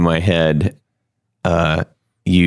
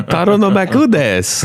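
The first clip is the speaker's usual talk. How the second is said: mock Japanese; 11 semitones higher than usual. Mock Japanese reply, laughing.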